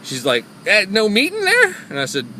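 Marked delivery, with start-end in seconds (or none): 0.6-1.8 loud, high, drawn-out questioning squawk; caricature of homeless woman. Retold or imitated. Driver imitating homeless lady rejecting biscuits, loud high caricature. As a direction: complain in an exaggerated mimicking voice. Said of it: "I'm sorry for the horrible caricature".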